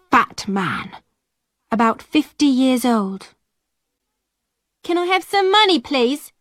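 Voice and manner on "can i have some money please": high, pleading call to a passing man; girl's high plea for money; begging girl calling out. A narrator's reading. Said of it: "Alison called".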